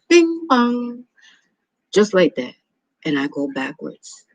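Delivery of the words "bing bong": sung-out high doorbell chime; doorbell imitation. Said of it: "the doorbell rang".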